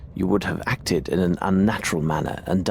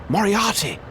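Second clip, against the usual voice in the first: shocked outcry; 11 semitones higher than usual. Acted. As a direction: cry out the name Moriarty in alarm. Startled recognition of old enemy.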